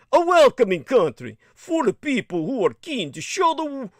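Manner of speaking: ooze false charm, mocking Infantino; slimy. Mocking Infantino's praise of Russia, slimy Italian accent. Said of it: "It was so slimy, I can't do it justice".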